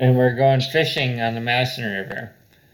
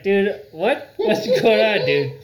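bewildered; 8 semitones higher, 3 dB louder than usual. Retold or imitated. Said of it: "I was like".

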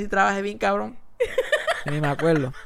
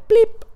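high pop sound effect; 5 dB louder than usual. Retold or imitated.

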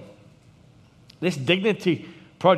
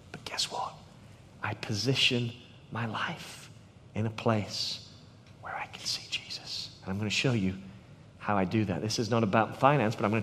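hushed; 8 semitones lower, 10 dB quieter than usual. Real, not acted. Preacher hushing the congregation to draw them in, near whisper. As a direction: drop to a near whisper, intimate.

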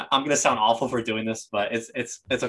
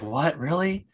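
mock surprise; 6 semitones higher, slower than usual. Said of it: "And he's like".

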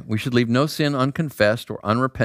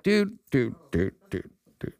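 muted exasperation; 5 dB quieter than usual. Acted. Inward reaction to the loud guy.

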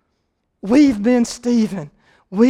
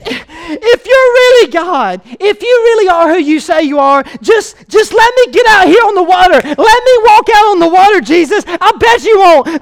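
defiant challenge; 5 semitones higher, 8 dB louder than usual. Retold or imitated.

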